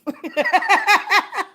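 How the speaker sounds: loud, high burst of giggling; uncontrollable laugh. Real, not acted. Laughing at grandma's stove, loud high giggling fit.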